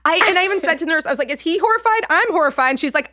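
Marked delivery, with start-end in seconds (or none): 1.3-2.6 high, comic horror; mortified. Retold or imitated. Patient asking nurse about the man, mortified. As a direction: blurt it out in pitched-up embarrassment.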